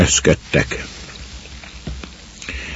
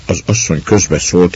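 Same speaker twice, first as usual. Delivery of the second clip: plain reading voice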